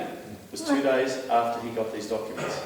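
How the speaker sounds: usual speaking voice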